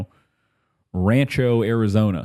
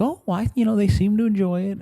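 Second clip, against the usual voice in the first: high, airy mock innocence; cheerful casino guy; 13 semitones higher than usual. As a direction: play the oblivious host, pitched way up. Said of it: "He's very, like, cheerful".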